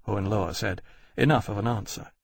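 usual speaking voice

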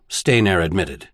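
plain reading voice

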